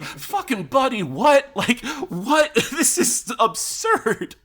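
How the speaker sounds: very high squeaky disbelief, laughing; incredulous. Retold or imitated. Reacting to Baltar's accent switch, very high incredulous shriek. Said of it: "It's like".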